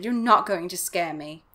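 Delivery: plain reading voice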